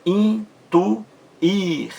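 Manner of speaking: Intuir in three slow, high, separate beats; syllable by syllable. Shown as a demonstration. Teacher counting três sílabas, Intuir spoken syllable by syllable. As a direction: break Intuir into in, tu, ir. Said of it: "Três sílabas".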